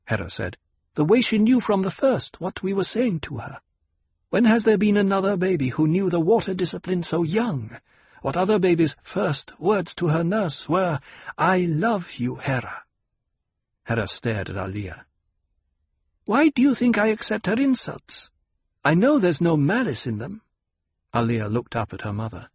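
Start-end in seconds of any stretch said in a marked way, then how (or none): 0.9-12.8 Fremen woman voice. Acted. Hera recalls baby Aliyah. 16.2-20.4 Fremen woman voice. Acted. Hera explains her patience.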